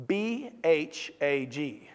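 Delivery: slow letter-by-letter spelling; spelling an acronym for the audience, slowly; spelled out slowly. Shown as a demonstration. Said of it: "It's an acronym".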